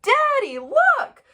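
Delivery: very high, loud squeal; squeaky kid showing off; excited child calling. A narrator's reading. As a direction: call Dad over like a thrilled kid. Child calls to father.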